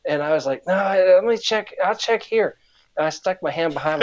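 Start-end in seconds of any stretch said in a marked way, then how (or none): 0.7-2.6 put-on high, hesitant kid voice; mock worried kid. Kid fakes checking his pants, high mock-worried voice. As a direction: lightly play the worried kid.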